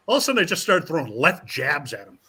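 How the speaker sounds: ordinary delivery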